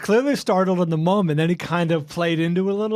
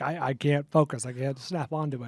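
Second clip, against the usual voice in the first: quiet struggling; 5 semitones lower, 8 dB quieter than usual. Retold or imitated. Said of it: "You're like".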